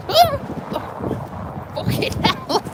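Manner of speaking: high shocked gasps and laughter; nearly fell in, shaken and laughing. Real, not acted. Nearly slipped into river.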